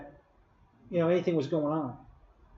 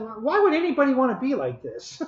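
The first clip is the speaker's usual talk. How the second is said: high, baffled question; asking the nurse why anyone wants this; puzzled; 7 semitones higher than usual. Retold or imitated. High baffled retelling. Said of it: "And I said".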